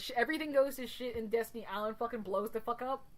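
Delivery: ordinary talk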